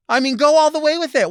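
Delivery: near-yelling dare; loud exasperated. Real, not acted. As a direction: shout it, fed up now. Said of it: "repeat louder".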